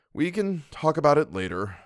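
ordinary delivery, nothing marked

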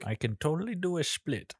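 bad, slurry Van Damme impersonation, softly delivered; soft, badly faked Belgian accent; botched Van Damme accent. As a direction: do a terrible Belgian accent that slides toward drunk Sean Connery. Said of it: "shitty fucking drunk Sean Connery".

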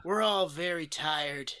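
a light attempt at a slow Hollywood cool guy; Kurt Russell impression. Shown as a demonstration. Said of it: "the best Kurt Russell I can do is just kinda talking like".

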